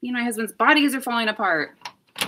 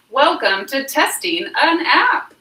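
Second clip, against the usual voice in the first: loud, high, drawn-out singsong welcome; big show welcome; 5 semitones higher, 7 dB louder, slower than usual. Shown as a demonstration. Host testing the video app's sound, big loud welcome. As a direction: announce it slowly with a rising finish.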